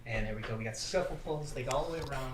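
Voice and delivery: hushed golf announcer voice; golf commentator. Acted. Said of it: "It's like golf commentary".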